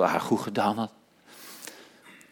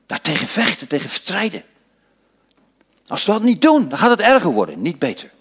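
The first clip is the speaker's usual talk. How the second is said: ordinary delivery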